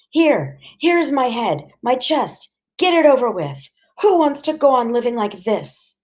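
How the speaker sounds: high-pitched, anguished mother; desperate challenge. A narrator's reading. Mother dares father to strike her.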